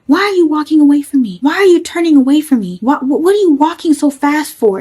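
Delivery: high and loud, accusing; imitating the drunk boyfriend yelling; retold yelling. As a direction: shout at her for walking away. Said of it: "he's screaming at me and yelling at me".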